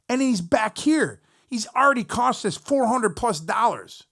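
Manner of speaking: heated rant. Retold or imitated. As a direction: vent every wasted dollar. Ranting at the band about costs.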